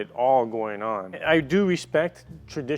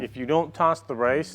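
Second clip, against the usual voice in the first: ordinary talk, nothing marked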